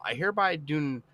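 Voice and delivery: normal talking voice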